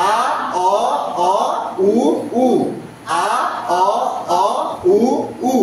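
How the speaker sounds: "আ, অ, অ, উ, উ" as isolated pure vowels; measured chant of "আ, অ, অ, উ, উ"; back vowel drill. Shown as a demonstration. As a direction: sound out each of "আ, অ, অ, উ, উ" separately. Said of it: "পাঁচটা back vowel".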